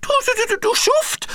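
Piggledy freezing, blaming Frederik: chattering; teeth chattering. A narrator's reading. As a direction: chatter the Du at him. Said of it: "klapperte nun auch Piggledy".

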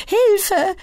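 very high, loud shriek. Acted. [name] being tickled by Lenny, shrieking. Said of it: "kreischt [name]".

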